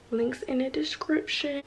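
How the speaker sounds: breathy whisper; whispered aside. Real, not acted. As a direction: slip the info in under your breath. Aside to viewers.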